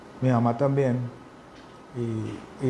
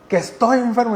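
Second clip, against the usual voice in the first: much higher and louder, yelling; retold yell of a frustrated sick man; shouting back; 9 semitones higher, 5 dB louder than usual. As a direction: shout it back in the heat of the fight. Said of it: "ya están gritando".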